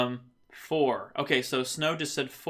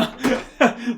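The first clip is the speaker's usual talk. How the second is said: high, loud burst of laughing; burst of laughter; 9 semitones higher, 5 dB louder than usual. Real, not acted. Host amused by the kale talk in chat, laughing out loud. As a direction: crack up, sudden and high.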